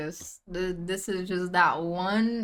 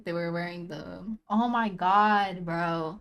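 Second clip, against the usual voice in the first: ordinary delivery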